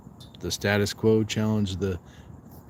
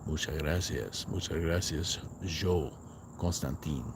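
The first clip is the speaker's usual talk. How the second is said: low, soft and silky, savouring the Spanish; smooth Spanish voice; 5 semitones lower, 9 dB quieter than usual. Pretend. Thanking Joe for praising his Spanish voice, low silky purr. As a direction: purr it low and quiet like a crooner. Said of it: "your voice sounds smooth in Spanish".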